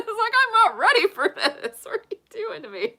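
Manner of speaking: high mock-crying protest through laughter; hammed-up sobby whine, cracking up; protesting little kid. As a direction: wail it like an overwhelmed child. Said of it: "it's like".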